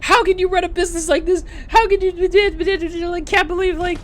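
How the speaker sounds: high, whiny mock outrage; mocking upset customer. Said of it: "She was getting all upset, going like".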